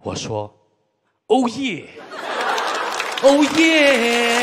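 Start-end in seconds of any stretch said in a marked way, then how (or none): 1.3-4.4 excited. Retold or imitated. Excited reply to director's offer.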